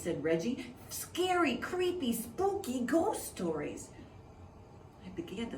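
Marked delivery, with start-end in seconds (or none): 0.9-3.9 spooky relish. Acted. Reggie savouring spooky words.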